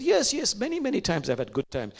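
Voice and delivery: high eager yes, yes; man eagerly recalling good times. Retold or imitated. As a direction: jump in eagerly. Man eagerly answers Rabia.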